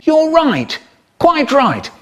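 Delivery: prim posh approval; pompous high voice. Retold or imitated. Man's pompous agreement.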